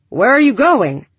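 loud, high call after her. Acted. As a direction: call out as she walks away. Breck calls after leaving Lyra.

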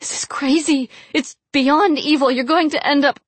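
high-pitched frantic woman's plea; desperate, almost sobbing. A narrator's reading. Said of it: "in a desperate, almost sobbing voice".

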